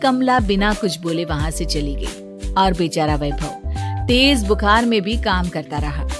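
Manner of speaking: plain reading voice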